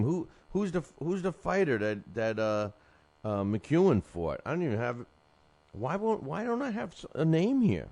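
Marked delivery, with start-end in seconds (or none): 5.7-7.9 can't believe the name is missing; high, incredulous complaint; exasperated disbelief. Real, not acted. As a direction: pitch jumps way up in disbelief. Host finds missing fighter name.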